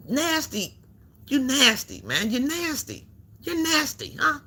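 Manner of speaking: high, mocking sneer of disgust. Real, not acted. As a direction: sneer it high like she's gross.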